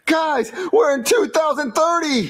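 slow, high-pitched cry; excited announcement. Acted. Time traveler tells friends they arrived, high drawn-out excitement. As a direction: call it out high, stretching the words.

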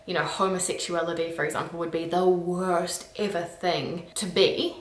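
normal talking voice

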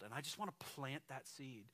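ordinary talk, nothing marked

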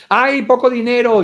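loud, high, crying alarm; hammed-up money panic; mock panicked wail. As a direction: wail it as if the world is ending. Acted panic over money.

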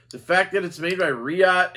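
ordinary talk

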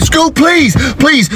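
high-pitched, urgent begging; alarmed pleading. Acted. Dad begging daughter to spare a girl, high pleading. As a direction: plead fast before trouble starts, voice high.

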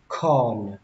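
ordinary talk, nothing marked

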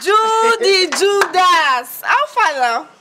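loud, stretched-out greeting; calling back. Acted. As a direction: sing out the nickname in reply. Card seller hails him back.

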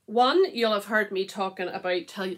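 usual speaking voice

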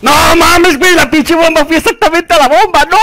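high, loud outraged wail; loud, high-pitched cry of dismay; anguished yelling. Real, not acted. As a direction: scream in disbelief at rotten luck. Bomb goes wrong, he despairs.

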